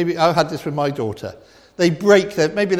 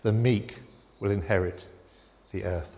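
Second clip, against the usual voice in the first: soft reverent quote; 8 semitones lower, 8 dB quieter than usual. Acted. Preacher gently quoting Jesus to the congregation, hushed and reverent. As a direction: drop right down and let the verse settle.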